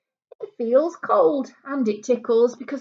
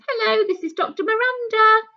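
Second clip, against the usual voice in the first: higher, brighter phone-call doctor voice; a cheerful doctor calling with good news, higher; 11 semitones higher, 4 dB louder than usual. Pretend. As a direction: play the doctor ringing a patient, louder and higher. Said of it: "they phone them up and say".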